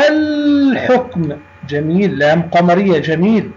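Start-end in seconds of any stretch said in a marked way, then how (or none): 0.1-1.4 الحكم slowly with a long, clear l; high, slow demo holding the l in الحكم; pronounced lam. Shown as a demonstration. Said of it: "اللام فوقها سكون". (نطق «الحكم» ببطء مع إظهار اللام الساكنة)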